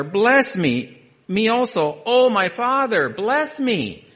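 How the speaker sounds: high, loud anguished begging; a son's loud, broken plea for a blessing; bitter crying plea. Acted. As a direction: cry out to your father, pleading. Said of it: "he cried with an exceedingly great and bitter cry".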